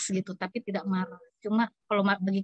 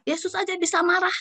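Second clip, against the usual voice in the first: much higher, imitating an angry outcry; mock the elder's cry, pitched up; retold outburst; 9 semitones higher than usual. Woman retells the elder shouting at the pastor, higher. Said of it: "dia langsung berteriak".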